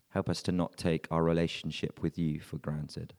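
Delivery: normal talking voice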